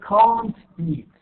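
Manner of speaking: CAN'T loud and full, MEET stressed too; stress on both words. Shown as a demonstration. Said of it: "Stress both can't".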